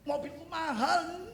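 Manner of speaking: Mobilku mahal said slowly with a laugh, lightly bragging; mock boasting. Preacher mocks people who brag about their cars, laughing and slow. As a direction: tease a show-off about his expensive car. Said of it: "jual mahal".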